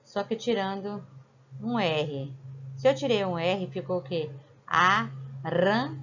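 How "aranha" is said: Aranha stretched slowly, syllable by syllable; teacher drawing out Aranha for kids; slow drawn-out word. Shown as a demonstration. Teacher to pupils, slowly spelling out Aranha. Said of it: "Se eu tirar um R".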